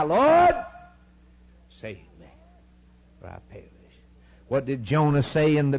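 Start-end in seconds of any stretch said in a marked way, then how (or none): 0.0-3.7 a sudden cry for rescue dropping to a hush. Retold or imitated. Preacher voicing the sinner crying out to God. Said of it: "And we begin to cry".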